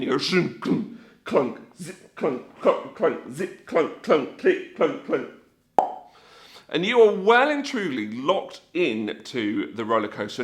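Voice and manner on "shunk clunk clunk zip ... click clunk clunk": mouthed mechanical clunks and zips; short percussive harness noises, one by one; imitated clunking. Rider locking the roller coaster bars, imitated clunking. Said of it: "you sort of clunk yourself in".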